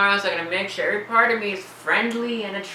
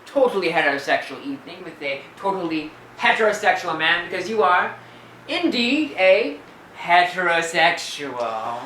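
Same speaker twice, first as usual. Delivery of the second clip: mocking. Teasing roommate about a totally heterosexual evening, mocking. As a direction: tease with exaggerated solemnity, stretching the last words. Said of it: "Your mocking is not particularly funny".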